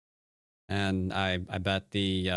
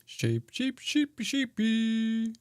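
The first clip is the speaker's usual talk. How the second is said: high, slow, drawn-out sheepy sheepy; silly high sing-song; 14 semitones higher, much slower than usual. Acted. Streamer playfully cooing at the sheep piece, high and slow. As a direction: croon the word like baby talk.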